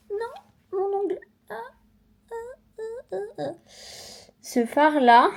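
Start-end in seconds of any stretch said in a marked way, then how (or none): 0.1-1.2 high-pitched dismayed cry over a broken nail; sudden high dismay; real dismay. Real, not acted. Breaks her nail on camera. 1.5-3.5 high whimpering ah cries; real little cries of pain; high pained whimpers; pained wails. Real, not acted. Pain after breaking nail.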